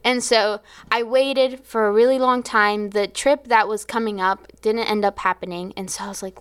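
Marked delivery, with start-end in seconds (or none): none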